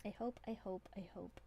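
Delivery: breathy whispered hope; whispering. Real, not acted. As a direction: whisper it softly to yourself. Quiet heartfelt wish.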